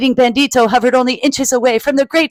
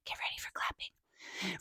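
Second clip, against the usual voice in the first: whisper; 25 dB quieter than usual. Real, not acted. Whispered aside.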